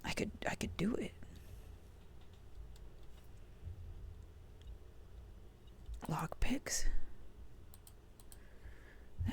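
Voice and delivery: hushed, breathy game whisper; whispering to self. Real, not acted. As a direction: whisper it under your breath. Whispering while playing.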